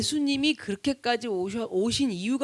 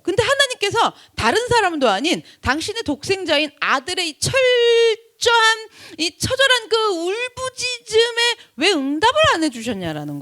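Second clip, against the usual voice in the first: impassioned preaching; 8 semitones higher, 5 dB louder than usual. Real, not acted. Preacher asking why God stayed silent, impassioned. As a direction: let the anguish push the words.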